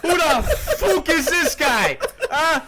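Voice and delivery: cracking up at the friend; laughing disbelief. Real, not acted. Friends on a podcast, laughing, mock-indignant disbelief.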